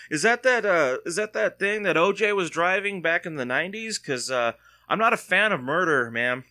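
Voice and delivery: fake-earnest questions meant to provoke; mock innocent teasing. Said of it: "Just kinda messing with this lady".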